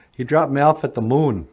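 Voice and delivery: ordinary talk, nothing marked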